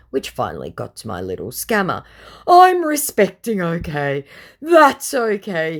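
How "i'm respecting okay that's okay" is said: high whiny voice. Retold or imitated. Scammer voiced, backing down.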